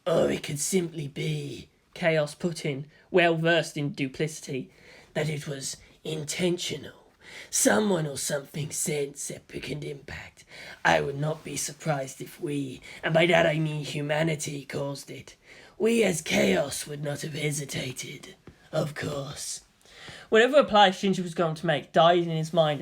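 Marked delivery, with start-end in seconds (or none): 0.0-1.7 slow breathy sinister whisper; Chaos, sly whisper. A narrator's reading. Chaos speaks slyly. 5.1-19.6 lightly voiced sinister schemer, low and slow; Chaos, sly. A narrator's reading. Chaos speaks slyly.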